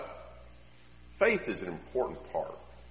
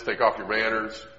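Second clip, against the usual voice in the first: ordinary talk, nothing marked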